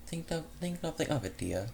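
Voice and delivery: a hint of a grand dame, softly; fancy exotic lady. Rescued mare reassuring her rescuer, lightly fancy and soft. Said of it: "fancy yet exotic tone".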